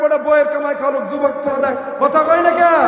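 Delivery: fiery preaching. Real, not acted. Preacher scolds sons who obey their wives, fiery loud preaching. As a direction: berate them at full force from the pulpit. Rouses the audience.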